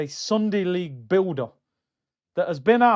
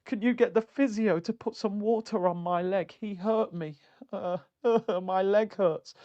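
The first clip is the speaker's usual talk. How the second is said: softer, mocking a player whining about his leg; a lightly voiced moaning footballer, softer; mock whiny injured player; 6 dB quieter than usual. Fan mimics a moaning player, mock whiny and softer. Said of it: "he's all sensitive".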